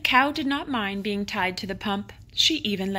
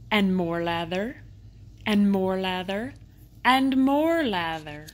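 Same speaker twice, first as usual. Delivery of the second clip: storyteller's growing amazement at the lather; building emphasis. A narrator's reading. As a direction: pile it on, swelling with the lather. Lather piling up ever more.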